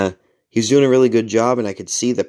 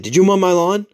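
higher, louder accusing question; retold aggressive demand; 10 semitones higher, 5 dB louder than usual. Man confronting the maintenance guy, aggressive. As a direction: confront him, pitched up and forceful. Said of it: "Like, really aggressively, like, sweaty, huffing".

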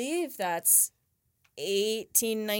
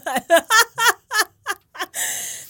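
high breathless laugh; 11 semitones higher, 10 dB louder than usual. Real, not acted. Giggling fit after cupcake.

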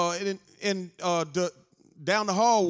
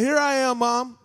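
put-on higher playful child answer; lightly voiced child; 7 semitones higher, 4 dB louder than usual. Little boy answering his mother from a clothes rack, playful. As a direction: answer like a small boy hiding.

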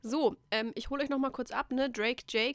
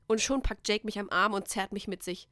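ordinary talk

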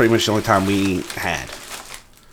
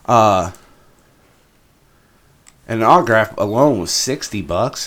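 ordinary talk

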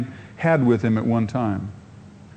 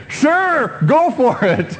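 eager crowd; 10 semitones higher, 4 dB louder, much slower than usual. Retold or imitated. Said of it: "what they said".

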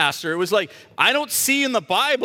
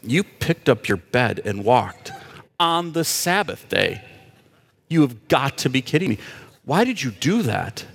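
lightly voiced indignant Pharisee, lower; a hint of a scandalised accuser; mock Pharisee scolding; 7 semitones lower than usual. Preacher playing the Pharisees to the healed man, indignant. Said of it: "You're going".

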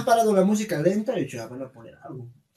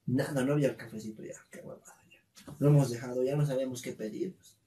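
ordinary talk, nothing marked

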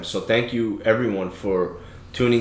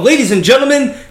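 ringmaster; 12 semitones higher, 7 dB louder than usual. Acted. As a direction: announce it to the big top. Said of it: "the old M C voice".

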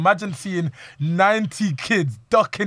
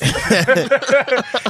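loud high laugh; 8 semitones higher, 6 dB louder than usual. Real, not acted. Laughing hard after imitating Ali's scream.

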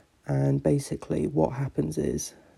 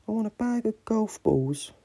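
higher, put-on little boy voice; child's voice; 7 semitones higher than usual. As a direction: play a three-year-old making his wish. Kid voiced in joke.

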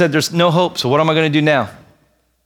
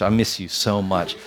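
tender aside; 6 semitones lower, 6 dB quieter than usual. Real, not acted. Tender aside to wife Kate.

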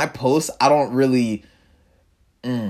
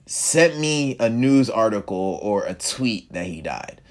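ordinary delivery

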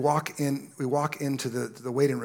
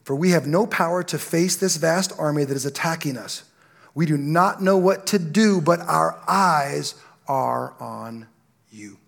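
louder, slow, fervent reading; fervent scripture reading; 3 semitones higher, 7 dB louder than usual. Acted. Pastor reading the king's plea to the church, fervent. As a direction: stretch out the final plea.

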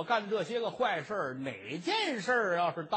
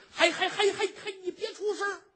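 high, stammering frantic shush; a scoundrel desperately hushing a screaming girl; panicked hush; 11 semitones higher, 3 dB louder than usual. Acted. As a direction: stutter in panic trying to silence her.

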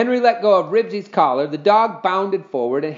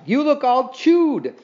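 horrified; 8 semitones higher, 3 dB louder, slower than usual. A narrator's reading. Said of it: "Mary Jane was horrified".

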